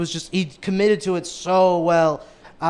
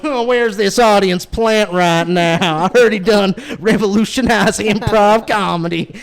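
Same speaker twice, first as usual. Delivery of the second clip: Davey; 5 semitones higher, 7 dB louder, slower than usual. Retold or imitated. Planted co-host heckling the host over a mic, mock yokel. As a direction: holler it out as a hammed-up country bumpkin. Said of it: "hear Davey with the mic going".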